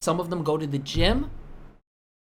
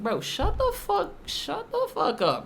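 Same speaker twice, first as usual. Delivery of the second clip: mocking; 11 semitones higher, slower than usual. Mock dismissive voice.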